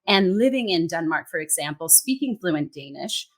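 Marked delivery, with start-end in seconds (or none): none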